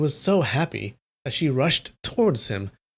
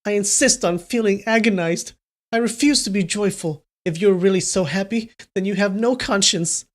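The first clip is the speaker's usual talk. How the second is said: higher pitched sulky crying girl, lightly voiced; 6 semitones higher than usual. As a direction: sob out a tantrum in a higher voice.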